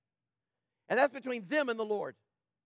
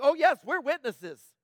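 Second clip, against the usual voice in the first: mock eager crowd; 5 semitones higher, 7 dB louder, slower than usual. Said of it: "They're like".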